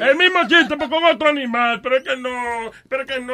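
high, pleading protest; exasperated wail. Real, not acted. Old man hearing the same joke again, exasperated wail. Protesta por el mismo chiste.

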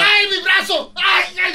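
pained cry. Real, not acted. Arm twisted in scuffle.

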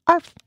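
a single sharp bark; short yelping arf; dog bark. Acted. Dog narrator barks.

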